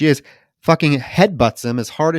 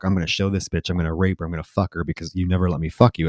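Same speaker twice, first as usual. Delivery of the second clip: low, quiet, fast muttered threat; a hint of the vicious husband, said under the breath; lightly voiced attacker; 6 semitones lower, 6 dB quieter, faster than usual. Husband threatening his captive, quiet, fast and lower. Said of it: "as he told her".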